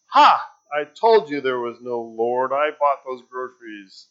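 loud, triumphant gloat. Acted. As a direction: jump out and crow over her. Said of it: "he said".

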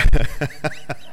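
loud, breaking into a run of chuckles; real laughter. Real, not acted. Preacher joking about telling wives what to do, laughing. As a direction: crack up at your own joke.

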